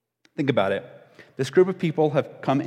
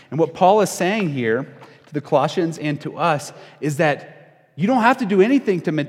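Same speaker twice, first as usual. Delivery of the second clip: normal talking voice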